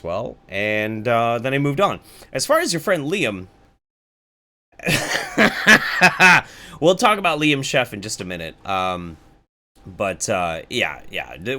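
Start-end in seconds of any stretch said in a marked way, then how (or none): 4.8-6.8 big burst of laughter; loud laugh. Real, not acted. Laughs at mention of Liam.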